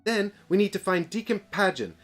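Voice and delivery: brief the troops with authority; commanding briefing voice. Acted.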